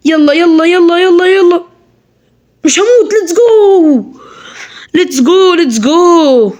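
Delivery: real gamer hype with a laugh; excited cheering. Real, not acted. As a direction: egg yourself on as you race to the finish. Racing through the level, cheering.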